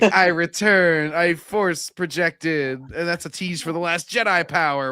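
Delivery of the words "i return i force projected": very slow, higher solemn pronouncement; mock solemn Ahsoka. Joking about the character's return, slow mock-solemn voice. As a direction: drag it out like a grand mystical return. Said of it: "She's like".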